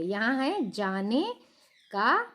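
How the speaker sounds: usual speaking voice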